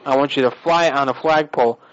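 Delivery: ordinary delivery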